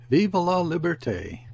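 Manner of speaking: high, loud revolutionary yell; a narrated, moderately loud rallying yell. A narrator's reading. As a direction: yell the slogan like a ruffian. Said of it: "yells that ruffian".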